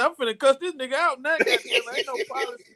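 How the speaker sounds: fast, high mock outburst of an angry woman; angry lady. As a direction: rattle it off like a fed-up lady. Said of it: "She said".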